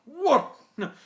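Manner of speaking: disgusted, pompous engine; Gordon. Acted. Engine appalled by filthy freight trains, in Gordon's voice. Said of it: "What about Gordon?".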